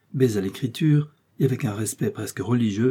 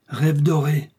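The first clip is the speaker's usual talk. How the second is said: exclaimed; 5 semitones higher, 4 dB louder, slower than usual. A narrator's reading. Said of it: "S'écria Aramis".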